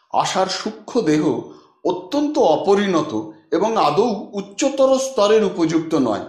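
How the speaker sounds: ordinary delivery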